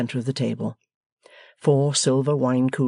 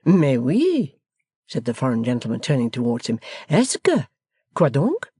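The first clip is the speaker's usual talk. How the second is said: a polite Frenchman prompting; French accent. Acted. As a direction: foreign guest turning to the young man. Foreigner answering.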